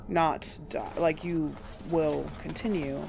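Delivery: normal talking voice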